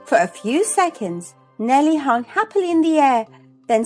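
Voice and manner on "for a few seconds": ordinary delivery, nothing marked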